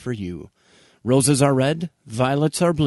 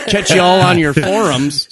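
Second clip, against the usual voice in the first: "Catch y'all" called out louder, like a mock cheerleader; hammed-up sign-off; 4 semitones higher, 6 dB louder than usual. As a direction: belt the goodbye with playful gusto. Repeating sign-off with gusto.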